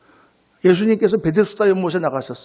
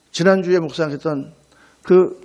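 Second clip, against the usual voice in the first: ordinary talk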